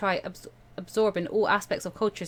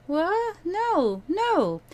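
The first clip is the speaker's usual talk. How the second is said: shocked refusal; 10 semitones higher, much slower than usual. Acted.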